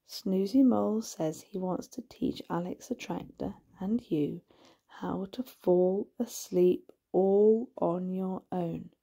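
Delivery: plain reading voice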